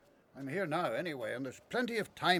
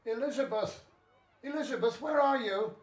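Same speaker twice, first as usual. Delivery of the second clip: raised voice calling through the house, higher; 9 semitones higher than usual. Acted. Zachariah calling out to find his wife. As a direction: call for her from another room.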